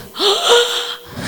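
high gasp of disbelief; sharp surprised intake of breath; amazed gasp. Retold or imitated. Amazed car started.

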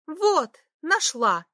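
slow, high joyful cry; excited shout. A narrator's reading. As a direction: call out triumphantly. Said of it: "крикнула она". (радостный возглас)

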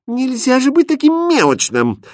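high-pitched exasperated complaint; vexed exclamation. A narrator's reading. Princess scolds Hrustald for haggling, vexed. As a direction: push it up high in annoyance. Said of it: "с досадой воскликнула принцесса".

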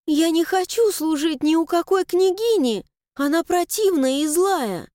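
put-on high, indignant girl's voice; upset young girl. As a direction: protest like a wronged girl. Mila's outburst.

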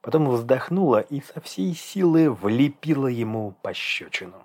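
ordinary delivery

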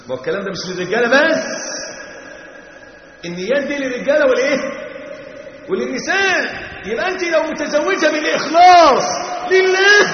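high, loud pulpit cry; preacher raising his voice to stress the lesson; fiery preaching. Acted. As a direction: drive the point home to the crowd. Preaching at full force.

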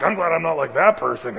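smug Pharisee. Retold or imitated. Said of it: "Well, like".

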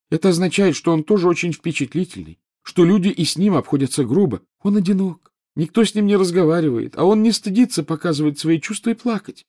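high, reproachful schoolgirl ghost; whiny ghost girl. A narrator's reading. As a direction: sulk like a neglected ghost girl. Narrator voices Myrtle.

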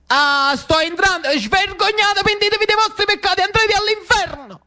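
very high, hammed-up denunciation; outraged priest. Don Luigino bursting in on the marchesa, high mock outrage. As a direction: wail damnation at sinners in a high voice.

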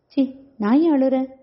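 ordinary delivery, nothing marked

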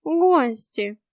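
doomed announcement. A narrator's reading. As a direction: announce it as inevitable doom. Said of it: "обречённо проговорил Васька".

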